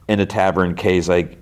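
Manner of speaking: normal talking voice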